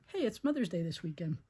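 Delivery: ordinary delivery, nothing marked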